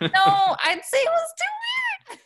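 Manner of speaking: very high, loud squeal of words; comic high-pitched protest; playful outcry. Real, not acted. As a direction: shoot the voice way up, half joking. Playful reply to interviewer's question.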